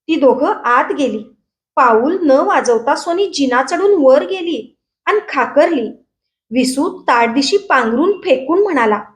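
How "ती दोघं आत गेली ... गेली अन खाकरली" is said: usual speaking voice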